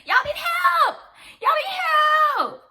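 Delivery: high, loud, slow yell; exasperated shout. Real, not acted. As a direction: scream it out in exasperation. Exasperated outburst at critics.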